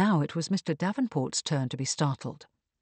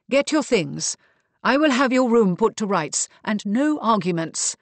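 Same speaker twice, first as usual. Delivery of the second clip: firm, louder orders; decisive, higher-pitched command; 5 semitones higher, 6 dB louder than usual. A narrator's reading. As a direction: hand out orders to end the matter. Mariana settles the issue with orders.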